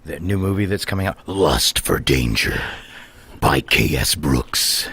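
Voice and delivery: deep, slow, dramatic announcer; movie trailer voice. Acted. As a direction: boom it out like a film trailer. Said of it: "movie trailer voice guy".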